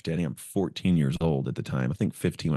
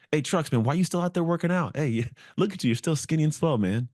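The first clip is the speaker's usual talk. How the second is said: much higher, jeering teammate voice; mocking teammate; 10 semitones higher than usual. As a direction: taunt him like a teammate laughing at the skinny kid. Teammates mocking him at workouts.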